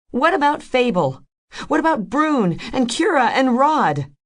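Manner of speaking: high, loud demand. A narrator's reading. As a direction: throw the names at him. Rose demands about bandmates.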